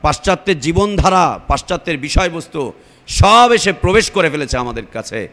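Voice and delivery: loud, driving sermon delivery; fiery preacher calling out; preaching at full force. Real, not acted. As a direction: hammer the warning home to the crowd.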